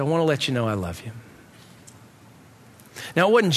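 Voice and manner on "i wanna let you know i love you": tender confession. Retold or imitated. Friend confessing love, crying.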